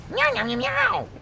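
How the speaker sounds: very high mewing meows; squeaky cartoon cat. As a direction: meow like a small cat. Voicing cat Nigel.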